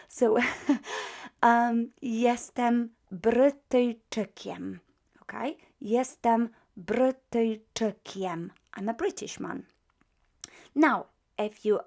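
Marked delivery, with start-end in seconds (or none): 2.0-8.5 jestem Brytyjczykiem said slowly with clear kiem; slow model phrase. Shown as a demonstration. Teacher repeating the phrase for students, slow. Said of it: "So, jestem Brytyjczykiem".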